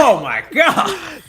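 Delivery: high, loud, drawn-out exclamation; excited outcry. Real, not acted. Watching a player get crossed up, loud astonished outcry. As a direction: stretch it out in amazed high-pitched shouting.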